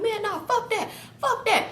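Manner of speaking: high put-on voice, swearing it off; imitating the drunk man brushing them off; mock defiant man. Imitating the drunk man refusing his friends.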